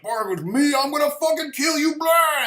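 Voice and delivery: high, loud, furious monster yell; enraged villain blasting the hero with a roar. Retold or imitated. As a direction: scream it like a cosmic tyrant losing it.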